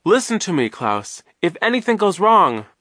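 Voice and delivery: high, loud pleading; urgent warning. A narrator's reading. Duncan warns urgently while abducted.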